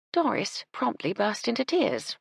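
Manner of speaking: usual speaking voice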